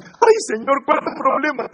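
mock a complaining prayer; whiny complaint. Shown as a demonstration. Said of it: "No es".

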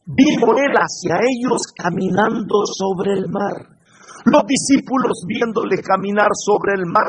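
ordinary talk, nothing marked

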